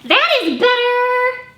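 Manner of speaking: a bossy eager little pig, bursting; Piggy. Acted. Librarian voicing Piggy showing off a trumpet to Gerald.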